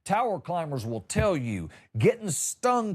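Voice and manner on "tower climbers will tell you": usual speaking voice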